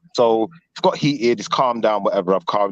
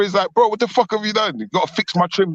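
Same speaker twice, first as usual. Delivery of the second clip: imitating a friend's raised, higher angry complaint; mock outrage at a ruined haircut, pitched up; a hammed-up vexed customer; retold aggressive outburst; 9 semitones higher than usual. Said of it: "he's got all aggressive now".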